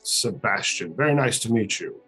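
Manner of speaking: normal talking voice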